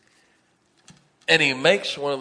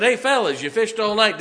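high and loud, hailing the boat from shore; calling out; 8 semitones higher, 6 dB louder than usual. Acted. Jesus calling to the disciples fishing, loud hail. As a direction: call out cheerfully to the fishermen.

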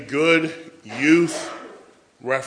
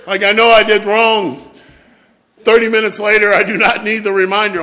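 higher and louder fed-up protest; exasperated outburst; 6 semitones higher, 11 dB louder than usual. Retold or imitated. Preacher voices his exasperated kid self, loud and high. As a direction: shout it like a kid sick of the reminder. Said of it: "I'm just like".

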